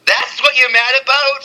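stunned you're-kidding-me reaction; incredulous disbelief. Retold or imitated. Mock disbelief at what angers people.

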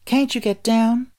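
put-on high, slow girl's question; young girl voice. A narrator's reading. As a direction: ask like a curious little girl. Dorothy asks the Scarecrow.

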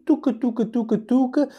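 high sing-song tuca tuca, like creeping feet; playful high footstep noises; tiptoeing sound effect. Retold or imitated. Walking slowly down the bus aisle.

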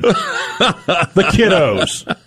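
mocking drawl. Mocks a word he hates.

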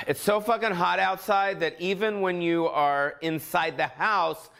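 normal talking voice

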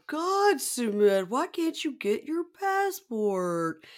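high, very drawn-out wailing complaint; singsong Jenny. Retold or imitated. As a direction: whine it slowly in a singsong impression. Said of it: "It's just a lot of".

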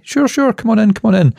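high sing-song welcome from a French lady; lady host. Retold or imitated. Retelling the watch stand welcome, high cheery lady voice. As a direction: lift it way up, cheery and inviting.

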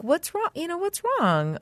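tender mother checking on her daughter; gentle asking. Retold or imitated. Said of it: "I was like".

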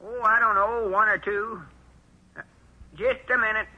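pitched well up to fool the caller; old man faking a high voice on the phone; high disguise voice. Acted. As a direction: put on a high voice to disguise yourself. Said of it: "I'll use my high voice".

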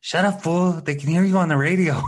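high, loud mock telling-off; imitated scolding girlfriend. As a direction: scold the boyfriend, hammed-up. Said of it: "And she's like".

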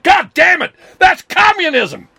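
high, loud hammed-up fury; mock conservative neighbour raging at lefties. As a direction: scream it like the sky is falling.

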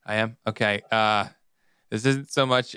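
ordinary talk

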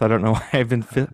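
ordinary talk

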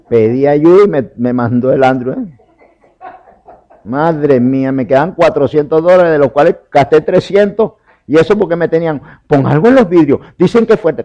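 loud, high-pitched preaching climax; a preacher shouting the hurricane story. Real, not acted. Preacher builds to loud ironic climax.

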